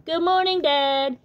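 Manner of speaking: high bright greeting; cheerful little Tad. Acted. Tad greets his dad in the morning, high and cheerful. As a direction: wake him up cheerfully.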